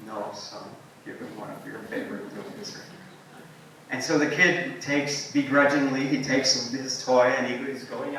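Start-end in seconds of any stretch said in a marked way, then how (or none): 0.1-2.8 low-key solemn father, much softer; quiet, weighty fatherly voice; Mufasa. Retold or imitated. Dad in a Lion King moment telling his son, softly solemn. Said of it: "the Mufasa Simba moment".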